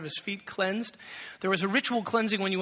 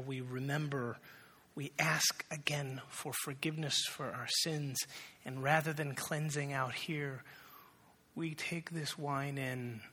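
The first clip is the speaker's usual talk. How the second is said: softer, slower, hushed pastoral voice; soft communion invitation; 4 semitones lower, 9 dB quieter than usual. Acted. Pastor invites the congregation to communion, hushed and tender. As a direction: draw them gently toward the table.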